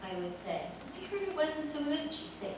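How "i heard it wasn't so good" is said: higher, softer teasing doubt. Retold or imitated. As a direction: needle her gently. Teasing Diane in retold banter.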